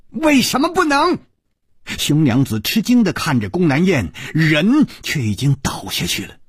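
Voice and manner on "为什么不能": loud outburst. A narrator's reading. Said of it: "宫南雁大声地说".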